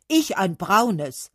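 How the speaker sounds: slow, loud, firm call. A narrator's reading. Oak claiming brown, loud slow call. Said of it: "rief die Eiche".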